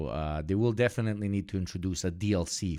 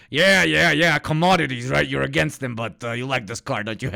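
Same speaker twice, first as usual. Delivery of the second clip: loud smug taunting voice; hint of a smug Putin; imitating teasing Putin; 4 semitones higher, 6 dB louder than usual. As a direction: needle him about liking the car. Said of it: "Putin teasing Kim".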